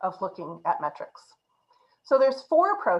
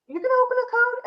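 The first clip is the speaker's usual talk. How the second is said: pitched way up, louder, mock disbelief; incredulous question; 5 dB louder, faster than usual. Developer retelling a colleague's disbelief, mock incredulous. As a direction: play the baffled colleague asking if he'll really open the code.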